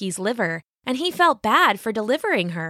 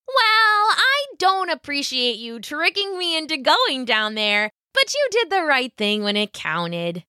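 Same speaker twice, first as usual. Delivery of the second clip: rabbit voice; 7 semitones higher than usual. A narrator's reading. Rabbit speaks.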